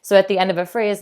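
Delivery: usual speaking voice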